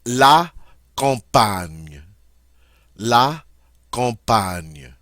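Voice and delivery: teacher modelling "La campagne"; French pronunciation. Shown as a demonstration. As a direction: stress the "ny" in "campagne". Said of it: "the G N here is pronounced like ny".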